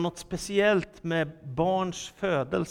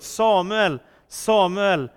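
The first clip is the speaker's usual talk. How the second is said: loud, measured call of a name; 4 semitones higher, 7 dB louder, slower than usual. A narrator's reading. The Lord calls the sleeping boy Samuel, calling out. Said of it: "ropade som förut".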